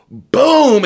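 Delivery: high, punchy boom; imitated impact. Telling friends how she was slammed, punchy imitated impact. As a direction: boom it out like a body slam.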